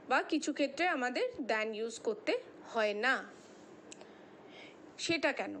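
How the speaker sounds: ordinary talk, nothing marked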